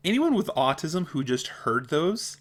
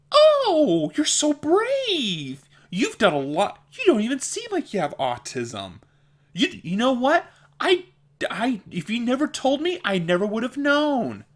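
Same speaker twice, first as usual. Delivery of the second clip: condescending puppy-talk voice; 11 semitones higher, 5 dB louder than usual. Retold or imitated. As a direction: imitate cooing over a puppy or injured animal, pitched way up. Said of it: "heard the voice. Like, like they're talking to a puppy".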